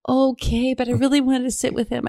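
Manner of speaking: high, disappointed mock wife voice; high and a little let down; the wife accepting she can't switch seats, high voice; imitating the wife. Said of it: "And she goes".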